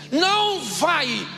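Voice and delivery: slow, stern growled refusal; gruff angry no. Retold or imitated. Uncle flatly refusing his wife, angry and slow. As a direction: bark it like a stubborn angry uncle.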